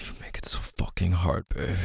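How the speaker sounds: breathy unvoiced whisper, close to the mic. Lover to partner in bed, whispered. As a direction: breathe it out without voice. Intimate roleplay, whispered line.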